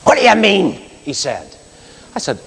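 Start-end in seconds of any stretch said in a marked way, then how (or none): 0.0-0.7 angry guard. Retold or imitated. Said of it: "And he was really angry with me".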